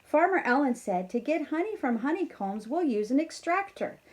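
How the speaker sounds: lively storybook read-aloud; reading to children. A narrator's reading. Teacher reading a farm story to kindergarteners, lively. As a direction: read it brightly to a class of kids. Reads picture book aloud.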